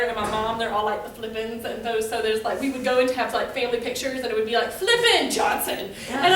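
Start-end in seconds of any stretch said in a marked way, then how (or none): 4.7-5.9 slow, higher photo call; mock calling out. Calling the two families for pictures, mock announcing. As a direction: imitate calling out family names, drawn out.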